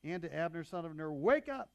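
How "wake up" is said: loud, high call across the camp; shouted. A narrator's reading. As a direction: shout it down the hill to wake them. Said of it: "He shouted down to the soldiers".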